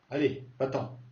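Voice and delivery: ordinary talk, nothing marked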